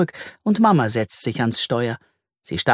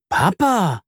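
a surprised call of papa, higher; astonished call; 6 semitones higher than usual. Acted. Leo sees his dad step out and calls in surprise. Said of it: "ruft Leo erstaunt".

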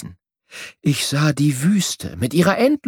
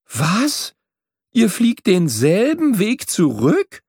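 drawn-out question in mild disbelief; aghast; 2 semitones higher, slower than usual. A narrator's reading. Young bird learns swallows fly back, aghast. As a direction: stretch the question with understated dismay. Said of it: "Ich war entgeistert".

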